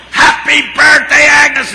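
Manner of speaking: high, full-volume surprise-party shout; celebratory yell. Retold or imitated. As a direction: yell it out slowly as if leading a crowd. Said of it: "we yell".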